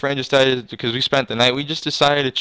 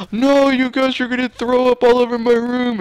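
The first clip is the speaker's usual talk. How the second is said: much higher, louder whining protest; whiny sister voice; 11 semitones higher, 4 dB louder than usual. Retold or imitated. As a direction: imitate the annoyed sister.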